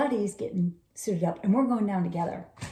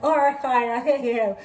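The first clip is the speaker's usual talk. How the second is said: grudging agreement; 4 semitones higher, 4 dB louder than usual. Retold or imitated. Gives in to being hushed.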